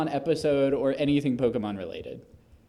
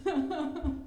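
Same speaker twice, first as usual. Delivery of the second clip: usual speaking voice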